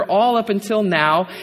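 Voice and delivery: ordinary delivery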